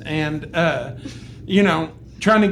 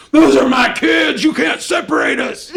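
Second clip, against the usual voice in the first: raised, loud protective yell; going crazy; 5 semitones higher, 4 dB louder than usual. Retold or imitated. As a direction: re-enact a frantic parent shouting at a stranger. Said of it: "I just went crazy on the guy".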